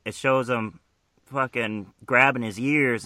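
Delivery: ordinary talk, nothing marked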